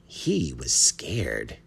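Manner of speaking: slow, emphatic triumph; drawn-out note of victory; triumphant. A narrator's reading. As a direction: savour each word with mild triumph. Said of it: "the bat thought triumphantly".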